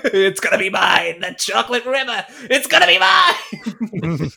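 hammed-up gloating, rising to a drawn-out cry; mock greedy villain. Host claiming the chocolate river, hammed-up greedy gloating. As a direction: play greedy, building to a laughing shout.

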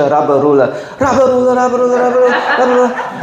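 rapid high babble breaking into a laugh; hammed-up tongues, sped up, ending laughing; mock tongues. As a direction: rattle it off fast, mocking the frenzy. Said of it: "just start speaking in tongues".

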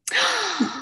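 a sudden high gasp of realization; sharp gasp. Retold or imitated. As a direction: gasp in shock as it clicks. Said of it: "And I was like".

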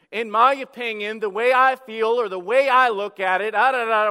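loud, emphatic preaching; preaching at full force. Acted. Pastor warns the congregation, loud and emphatic. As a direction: drive the warning home.

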